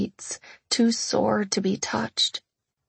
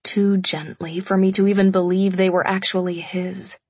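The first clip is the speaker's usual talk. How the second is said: plain reading voice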